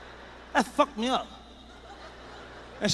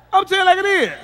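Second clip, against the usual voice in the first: emphatic shout; 10 semitones higher, 9 dB louder, faster than usual. Acted.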